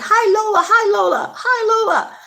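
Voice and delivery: high, loud rapid-fire greeting; spamming hellos. Retold or imitated. Spamming hi to Lola in the live chat, high-pitched and excited. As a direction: squeal hi over and over, high and loud. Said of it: "I was, like, spamming".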